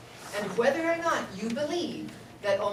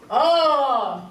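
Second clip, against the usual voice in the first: louder open oh, full of air; loud breathy oh; 3 semitones higher, 8 dB louder than usual. Shown as a demonstration. Teacher showing ladies a sound they rarely make, loud oh. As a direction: let out a big airy oh. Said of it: "this is a sound that most women don't make".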